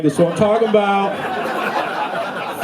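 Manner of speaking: drawn-out mock-proud boss; slow hyped supervisor. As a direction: stretch it out like a proud bro boss. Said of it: "supervisor who was like".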